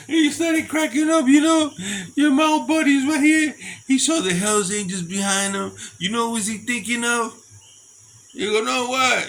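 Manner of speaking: loud high excited retelling; excited laughing. Retold or imitated. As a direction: crow with delight at the joke. Retelling Dick joking, laughing loudly.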